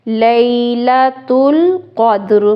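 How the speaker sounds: slow chanted tajweed recitation; Quran recitation. Shown as a demonstration. Teacher recites the whole verse for students. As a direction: recite the verse slowly and melodically.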